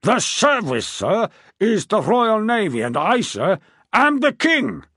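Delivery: a king pulling rank, voice climbing; rising anger. A narrator's reading. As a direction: let the royal temper build phrase by phrase. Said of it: "The voice began to rise as he continued".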